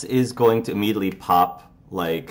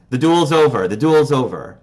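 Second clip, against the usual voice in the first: loud, higher call to stop the duel; 5 semitones higher, 6 dB louder than usual. Acted. Headmistress calls out to the duelists, loud and commanding. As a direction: raise the voice and halt the fight.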